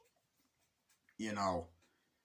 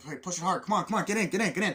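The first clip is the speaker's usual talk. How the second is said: high, loud repeated commands; rally someone frantically; urgent urging; 8 semitones higher, 6 dB louder, faster than usual. Retold or imitated. Friend hurrying the other into the car, urgent urging. Acting men pushing, jumping in car.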